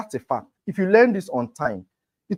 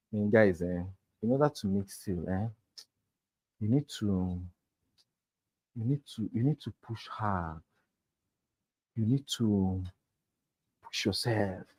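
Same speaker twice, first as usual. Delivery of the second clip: quiet, hesitant, flat-out unconvincing pitch, softer; low-energy sales talk; 6 semitones lower, 9 dB quieter than usual. Shown as a demonstration. Sales coach demos a weak pitch to students, soft and hesitant. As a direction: mumble it like you don't believe yourself, hemming and hawing. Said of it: "My words and my action is different".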